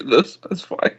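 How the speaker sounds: ordinary delivery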